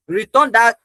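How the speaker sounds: sharp, loud return that; loud demand. Acted. Demanding his book back.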